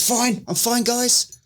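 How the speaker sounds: high-pitched, over-keen reassurance to the lads; insisting he's fine. Retold or imitated. Insists he is fine after blackout.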